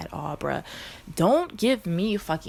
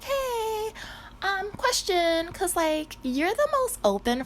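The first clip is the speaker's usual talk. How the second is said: high, sweet coaxing question; butter up a friend; high girly retold ask; slower than usual. Retells asking her girlfriend.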